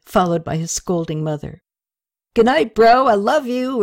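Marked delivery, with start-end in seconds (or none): 2.3-3.8 louder, higher tipsy goodbye; drunken call out. Acted. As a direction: call affectionately after him. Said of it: "Rene called out".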